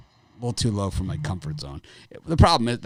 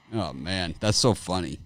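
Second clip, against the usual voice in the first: ordinary delivery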